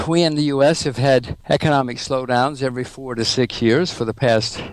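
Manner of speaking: ordinary delivery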